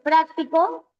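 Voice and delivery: práctico stressed on its first syllable, PRÁC-ti-co; práctico as an esdrújula, weight on PRÁC. Shown as a demonstration. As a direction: punch the opening PRÁC of práctico. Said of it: "tenías que cambiarle el acento".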